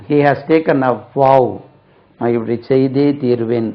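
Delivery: ordinary delivery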